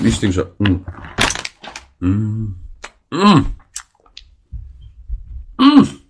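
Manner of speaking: rising delighted mm sounds; pleased mmm while tasting; savoring mm. Real, not acted. Tasting chocolate strawberry.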